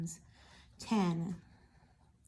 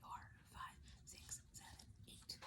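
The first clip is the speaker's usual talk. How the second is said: soft whispered counting; hushed counting aloud; whispering the count; 26 dB quieter than usual. Shown as a demonstration. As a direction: whisper the numbers to show the pattern. Teacher counts pattern in whisper.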